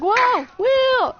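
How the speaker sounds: high, loud startled shout; acted excited call. Mike hearing footsteps in the woods, excited call. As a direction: call out as if you've spotted him. Thinks he found his friend.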